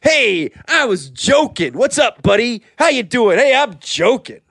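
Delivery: high-pitched, over-friendly ribbing; jokey buddy banter. Shown as a demonstration. Co-host showing how you joke with a buddy, playful ribbing. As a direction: kid around like an over-familiar pal. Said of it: "It's like that, you know, you, you, you joke".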